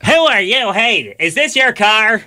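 high, indignant busybody voice; self-righteous. Retold or imitated. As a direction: play the smug do-gooder. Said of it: "he's so self-righteous about it".

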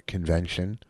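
ordinary delivery

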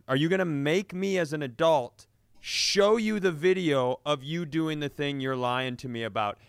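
normal talking voice

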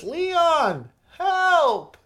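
Ashley's falsetto cry for help; high whiny mock plea for rescue; high pleading cry. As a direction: squeal it way up high, like a helpless girl calling out. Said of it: "and it was".

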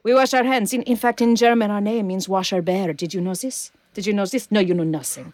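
put-on French accent turned Russian; Melinda LaFleur. Raccoon thief schooling her captive, Russian-tinged accent. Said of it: "see, she's turning Russian".

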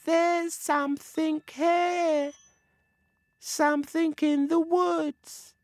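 high, slow sing-song spirit call; eerie sweet voice calling through the woods; sweet, gentle voices. A narrator's reading. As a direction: float it out high, drawn-out and gentle. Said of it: "he heard some sweet, gentle voices saying".

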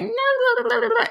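high, loud mock-ranting blah-blah. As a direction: rattle off squealed mock-argument noises. Mimics own Twitter arguing.

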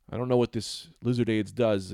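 usual speaking voice

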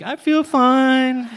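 high on ecstasy. Retold or imitated. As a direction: float it out like you're high. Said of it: "I'm high on E".

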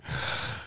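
sharp intake of breath; awed gasp. Retold or imitated. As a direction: gasp at a dream offer. Retold reaction to job suggestion.

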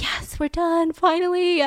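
relieved delight. Retold or imitated. Attendant over the moon.

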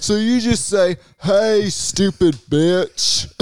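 high, drawn-out "Hey, stupid bitch". Pretend. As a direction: stretch it out slowly, up high and sassy.

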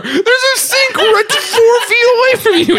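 very high, laughing outcry; real tearful laughing indignation; laughing to tears. Real, not acted. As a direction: protest in a high wail, crying with laughter. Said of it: "I've got my tears on the carpet".

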